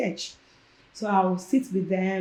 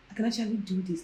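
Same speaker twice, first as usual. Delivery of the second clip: much softer, "actually" murmured to herself; quiet echo; 7 dB quieter, faster than usual. Retold or imitated. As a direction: repeat "actually do this" softly to yourself. Repeating the self-talk quieter.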